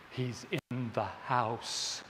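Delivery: low, slow hushed fear; "He's in the house" said slow and low, full of dread. Retold or imitated. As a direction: breathe it out low and slow, scared stiff. Retold horror scene, terrified viewer.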